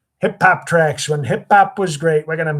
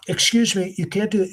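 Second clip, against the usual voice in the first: higher voice placed out in the mouth; easy Trump impression; 6 semitones higher than usual. Shown as a demonstration. Impressionist showing the easy version, high mouth-placed voice. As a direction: do the cheap, mouthy Trump, pitched up. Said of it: "it's out of the mouth".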